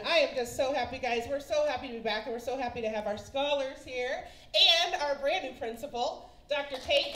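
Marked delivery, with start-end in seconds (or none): none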